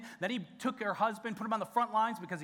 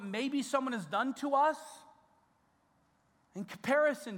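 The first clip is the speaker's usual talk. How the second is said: raised, louder pleading preacher voice; fervent preaching; 7 semitones higher, 4 dB louder than usual. Acted. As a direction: plead with the congregation, full force. Pastor preaches at full force.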